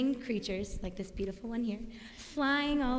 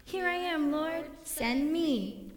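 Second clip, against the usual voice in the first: higher, slow and ringing; with an exclamation mark; 5 semitones higher, slower than usual. Shown as a demonstration. Teaching the children to answer God's call, excited. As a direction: proclaim it like an exclamation. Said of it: "we can say with an exclamation mark".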